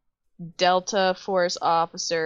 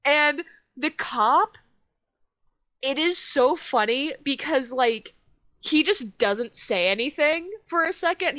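amused excitement; 7 semitones higher than usual. Real, not acted. Laughing while telling cop story.

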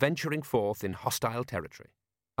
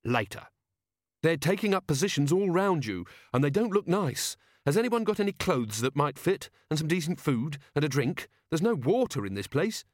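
lightly voiced impatient woman, pitched higher; brief them urgently, higher than usual; urgent Angua; 5 semitones higher than usual. Angua demanding clothes and water, urgent and impatient.

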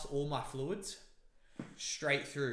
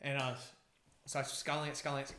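ordinary talk, nothing marked

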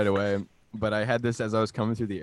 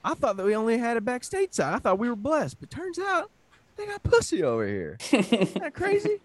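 amazed southern American; 11 semitones higher than usual. Acted. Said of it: "funny bit ... this American guy".

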